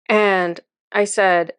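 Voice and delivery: normal talking voice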